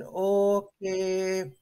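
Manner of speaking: long drawn-out, level okay; hesitant thinking. Retold or imitated. Pilot answering the instructors' hard question, hesitant and drawn-out. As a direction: stretch the word out while thinking it over.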